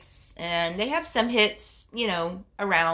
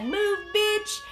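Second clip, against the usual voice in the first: high, mock-urgent order; hammed-up push past, pitched high; imitated pushy sister; 11 semitones higher than usual. Sister shoving her out of the way, imitated urgency.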